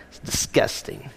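a soft, voiceless whisper of disgust. Real, not acted. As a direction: breathe it out, repulsed. Preacher reacting to dog vomit.

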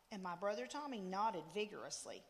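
usual speaking voice

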